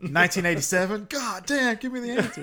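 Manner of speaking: high-pitched, hammed-up desperate guessing; mock exasperation. Co-host joins in re-enacting the quiz scene, exasperated. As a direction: blurt a guess, then plead in mock frustration.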